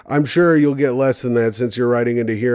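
ordinary talk

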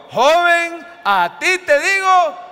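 high, loud and slow command; with authority. Shown as a demonstration. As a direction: order death itself to stop. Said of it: "Se lo dijo con autoridad". (con autoridad, fuerte y lento)

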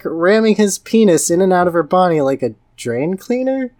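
ordinary delivery

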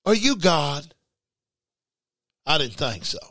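ordinary talk

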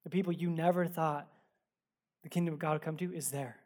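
normal talking voice

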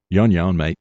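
lightly imitated Australian accent; Simon's Aussie accent. Actor retelling a co-star's on-set brush-off, mock Aussie accent. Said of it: "in his Aussie accent".

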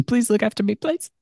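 high, pitiful little plea; mock pleading. Acting out needy parent's plea.

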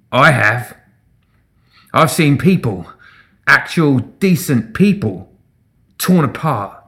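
deliberate crowd-rousing speech; impassioned testimony. A narrator's reading. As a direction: lay out each phrase with grim weight. Agitator rousing crowd against undead.

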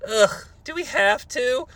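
slow whiny groan of a question; mock whine. Sonny to host, slow mock-whining complaint. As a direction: whine like a bored kid. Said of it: "I'd rather not".

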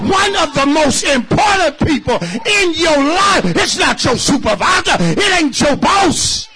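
loud whooping sermon, pushing every line; full-force preaching. Real, not acted. Pastor whooping to the church about needing preachers. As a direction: preach it hard to the congregation.

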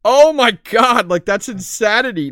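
real loud, slow amazement at the numbers; oh my God said high and loud, stretched out; amazed. Real, not acted.

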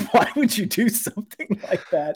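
high retold scolding through laughter; giggly mock reproach; laughing reproach. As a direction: crack up while imitating the reproach.

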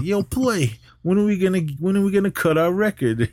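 high, eager questioning voice; eager Pee Wee. Retold or imitated. Pee Wee pressing Play about the record, high and eager. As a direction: ask it like an impatient fan pushing.

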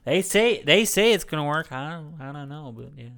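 New Orleans coworker impression; Jimmy. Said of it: "He's, he's just like".

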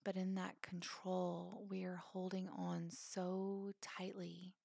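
calm, spacious phrases with long pauses; guided meditation. Acted. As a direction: gently guide them into letting go.

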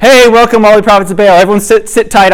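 high, loud greeting to a crowd; mock host's welcome. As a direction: imitate a host heartily welcoming his guests. Jehu falsely welcomes the gathered prophets.

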